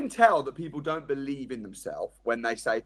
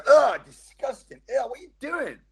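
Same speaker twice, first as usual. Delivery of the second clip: mock disgust; 8 semitones higher, 3 dB louder than usual. Parent mocking disgust at a baby who was sick. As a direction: act grossed out by the baby, half in jest. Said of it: "you don't go".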